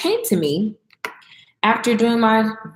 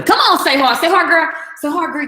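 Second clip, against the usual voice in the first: hype; 7 semitones higher, 5 dB louder than usual. Real, not acted. Hyping up the grits on camera.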